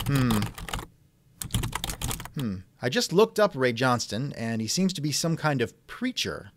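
ordinary delivery

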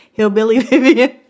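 put-on laughing hillbilly country accent. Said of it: "I've morphed into the Vivian from the country".

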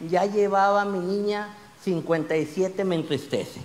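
normal talking voice

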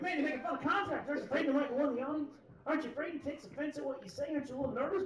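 high, fast worried questions; fretful, high-pitched mock concern; anxious questioning. Retells friends' worried questions.

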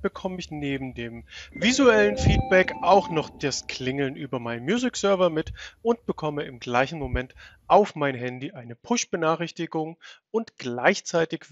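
ordinary talk, nothing marked

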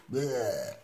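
plain reading voice

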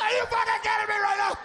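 high, shouted outrage; wife-style shriek. Retold or imitated. Mocking his wife's high shouted outrage at the gauge. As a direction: screech it like she's furious at the car. Yelling at empty gas tank.

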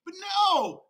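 high, very loud exclamation; outraged shout. Real, not acted. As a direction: burst out in mock outrage. Outraged turn against Archie.

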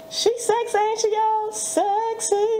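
high playful hype voice; high teasing hype for her friend; hype-up tease. Pretend. Hyping friend to camera.

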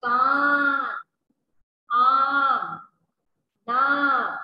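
कान, आम, नाम said loud with the long aa drawn out; teacher calling out कान, आम, नाम with long aa; long aa demo. Shown as a demonstration. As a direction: call out कान आम नाम to the class, stretching the aa. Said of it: "इसलिए इसे हम बोलेंगे".

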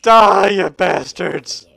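loud, drawn-out attack yell; battle cry. Pretend. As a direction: roar at the enemies as you strike. Attacking enemies in game.

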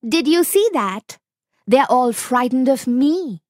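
high, smug fox voice; sly fox enjoying his trick; gloating fox. Acted. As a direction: fox smugly teasing the tiger, high-pitched.

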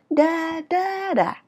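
high drawn "Da, da", dropping on last "da"; sliding up and down. Shown as a demonstration. Teacher tracing the tune for learners, sliding up and down. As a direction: hum the contour on "da", high then falling. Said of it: "Cũng trượt lên và trượt xuống".

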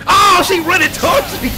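high, loud panicked yell; panicked shout. Real, not acted. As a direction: yell it as she charges you. Boss charges at player.

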